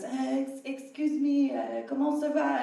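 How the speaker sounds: high-pitched, hesitant Frenchman; French stranger. Retold or imitated. French guy startling a woman alone in the kitchen, hesitant French stranger. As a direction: politely interrupt in a high French voice. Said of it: "French guy just standing in the middle".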